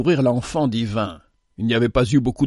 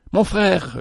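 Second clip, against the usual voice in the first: calling out; 6 semitones higher than usual. Acted. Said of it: "lui cria-t-il".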